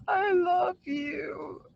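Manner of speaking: high, slow, drawn-out crying wail of love; mock whiny girlfriend. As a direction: stretch it out like a sobbing, clingy girlfriend. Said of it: "she goes".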